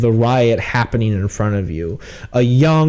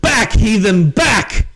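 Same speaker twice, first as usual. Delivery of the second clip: high, forceful guard command; guard's order; 7 semitones higher, slower than usual. Acted. Guard shoves back a shouting wizard, forceful order. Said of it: "Flaming Fist guard looks up at you and goes".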